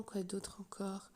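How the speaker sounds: whispering, slow and soft; whispered jab. Real, not acted. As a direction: hiss it like a sly aside. Mocking list of needs.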